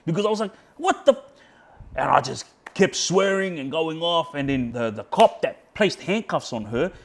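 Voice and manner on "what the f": very high, loud squawk of disbelief; outraged disbelief. Retold or imitated. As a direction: shoot up in pitch, stunned and furious. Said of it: "made me even more pissed off".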